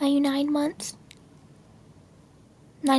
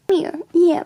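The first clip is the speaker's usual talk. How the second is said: ordinary talk, nothing marked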